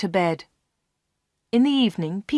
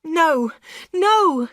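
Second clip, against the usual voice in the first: high, frightened, louder refusal; frightened cry; 9 semitones higher, 4 dB louder than usual. Acted. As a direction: push him away with fear in the voice. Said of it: "she cried".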